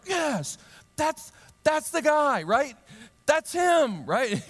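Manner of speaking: high, loud, eager identification; excited pointing out. Retold or imitated. Healed man spotting Jesus to the leaders, high and excited. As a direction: point him out, thrilled. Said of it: "and he's like".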